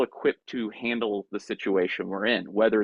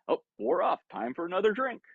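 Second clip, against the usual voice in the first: much higher, sing-out "oops" delivery; breezy self-prompt; 9 semitones higher than usual. Acted. Speaker replays the drink wearing off at a party, breezy and high. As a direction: pipe up lightly, as if it's routine.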